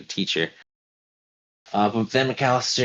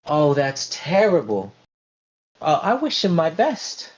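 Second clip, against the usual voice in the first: sympathetic dance teacher; 8 semitones higher, 3 dB louder than usual. Acted. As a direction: soothe her in a high voice. McAllister consoles Lombardi.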